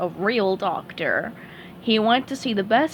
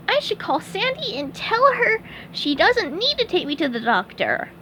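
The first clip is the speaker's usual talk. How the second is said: very high squeaky cartoon sponge; chirpy high-pitched sponge, cheerful; SpongeBob voice; 9 semitones higher than usual. SpongeBob praising Doctor Patrick, high squeaky sponge voice.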